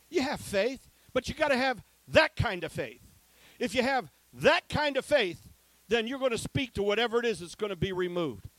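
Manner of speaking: fired-up pulpit preaching. Acted. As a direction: preach it hard to the congregation. Preacher drives home faith.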